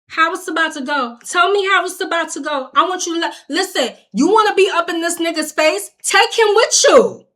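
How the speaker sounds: heated re-enacted argument, building; confrontational. Retold escalating argument with officer.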